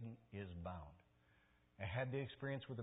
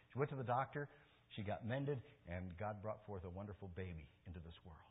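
usual speaking voice

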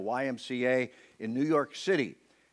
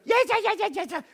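very high, rapid eager yeses; eager kid; 4 dB louder, faster than usual. Retold or imitated. Preacher voicing the kid eagerly agreeing, very high and quick. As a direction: chirp it out impatiently. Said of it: "are you absolutely certain".

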